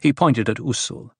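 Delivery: ordinary delivery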